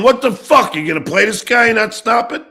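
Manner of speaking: loud, high angry outburst at a friend; retold furious yell. Yelling at a friend for not stopping the tape, furious. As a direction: blast it like you can't believe the betrayal. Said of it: "Me going".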